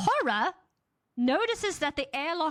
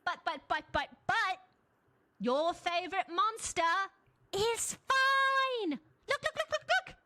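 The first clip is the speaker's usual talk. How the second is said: rising, pitched-up eager look look; excited high chatter; 6 semitones higher than usual. Acted. Narrator voicing the Doctor pointing out the monster, high and eager. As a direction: babble it eagerly, climbing higher.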